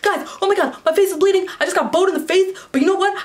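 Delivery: high-pitched, breakneck excited babble; hyper excitement. Retold or imitated. Hurt festival-goer telling friends her face is bleeding, hyper and excited. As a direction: re-enact hyped-up rambling, words tumbling out. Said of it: "hyper and excited that I was just talking like a million words per minute".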